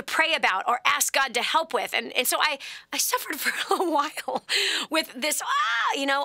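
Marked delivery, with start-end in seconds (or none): 5.4-6.0 short high mock scream; imitated scream. Mother showing what she suffered all the time, mock scream. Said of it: "screaming all the time".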